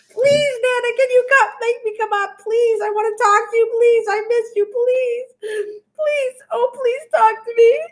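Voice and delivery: high whiny pleading voice; crying, high-pitched plea; mock begging Mike. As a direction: beg like a pitiful little boy. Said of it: "that's how Mike sounds exactly".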